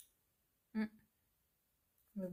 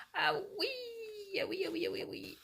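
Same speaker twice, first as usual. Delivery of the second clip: squeal; 11 semitones higher, 4 dB quieter, faster than usual. Real, not acted. Reacting to the cat Mimique.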